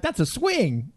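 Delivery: high-pitched mock commentary; clueless commentator. Clueless partner at the baseball audition, high mock commentary. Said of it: "Bethel knows nothing about baseball".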